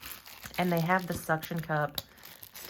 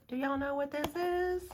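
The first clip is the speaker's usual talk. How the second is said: much higher silly voice, then a high sigh; squeaky put-on voice; 11 semitones higher than usual. Teasing her viewers, repeating the question in a squeaky voice. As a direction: ask again in a squeaky cartoon voice.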